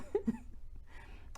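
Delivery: soft, high, breathy laugh; self-amused laugh. Real, not acted. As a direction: laugh quietly at your own words. Laughs at her own phrase.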